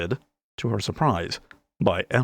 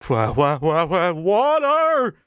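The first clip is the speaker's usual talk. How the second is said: much higher, louder stutter, slow on water; stammering exclamation; 9 semitones higher, 5 dB louder, slower than usual. A narrator's reading. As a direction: sputter in disbelief. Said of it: "Quill exclaimed".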